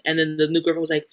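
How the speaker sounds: normal talking voice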